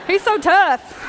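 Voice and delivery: mock Southern tough-guy praise; Southern accent. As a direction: put on a drawn-out Southern accent. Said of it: "I don't know why he has a Southern accent".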